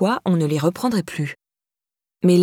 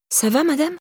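higher-pitched, questioning lift at the end; concerned stranger; 8 semitones higher than usual. A narrator's reading. Passing girl to a crying older woman, concerned. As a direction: ask after her in a raised, concerned pitch.

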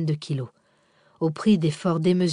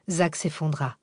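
ordinary delivery, nothing marked

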